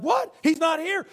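high shocked yelp; freaked-out disciples. Retold or imitated. Disciples find the empty tomb, shocked and high. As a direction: blurt it in disbelief.

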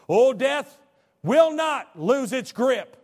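loud, high, slow proclamation; preaching at full force. Acted. Preacher thunders to the congregation, loud and slow. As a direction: hammer each word home.

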